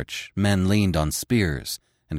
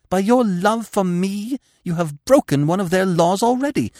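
fierce passion; 7 semitones higher than usual. Acted. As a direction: press him with wild passion. Fierce seduction continues.

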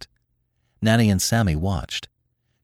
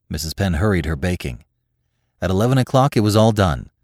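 ordinary delivery

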